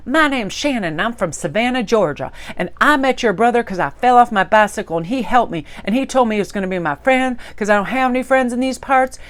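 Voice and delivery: Shannon. Retold or imitated. Spitfire from the South introducing herself, in a Southern accent. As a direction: imitate a chatty girl's Southern accent. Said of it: "she had this Southern accent".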